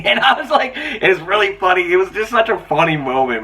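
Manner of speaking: high-pitched, laughing through the words. Real, not acted. Reviewer tickled by a funny movie moment, laughing. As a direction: crack up while recounting it. Cracking up over the retold joke.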